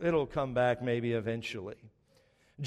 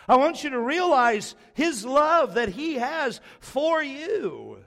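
raised, emphatic sermon delivery; impassioned preaching; 4 semitones higher, 4 dB louder than usual. Real, not acted. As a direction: preach it out, punching the key words and stretching them. Preaching at full force.

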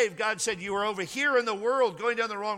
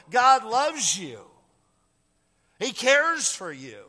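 louder and slowed, every word punched; slow emphatic declaration; 4 semitones higher, 6 dB louder, slower than usual. Real, not acted. Pastor to congregation, slow loud insistence. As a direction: hammer home the truth.

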